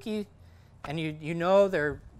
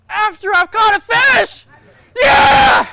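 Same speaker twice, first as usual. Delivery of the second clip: high, loud victory scream; celebratory yell after a catch; excited scream; 20 dB louder, slower than usual. Shown as a demonstration. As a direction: scream it like you just landed one. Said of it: "pretty sure you screamed on the boat".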